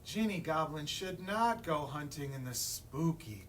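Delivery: ordinary delivery, nothing marked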